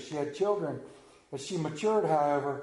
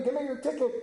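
lightly voiced stern conductor, a bit higher; 8 semitones higher, faster than usual. Conductor to the know-it-all boy, lightly voiced brisk demand. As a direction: ask for the ticket like a brisk train conductor. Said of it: "he says to the young man".

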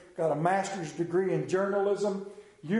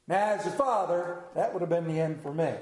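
normal talking voice

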